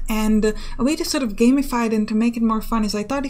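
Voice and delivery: usual speaking voice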